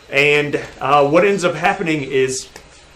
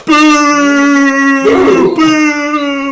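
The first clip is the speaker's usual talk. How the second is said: loud high booing crowd; 10 semitones higher, 6 dB louder, much slower than usual. Acted. Gnome crowd jeering the Raunchy Twins, loud high boos. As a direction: boo them like a hostile mob. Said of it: "they all start going".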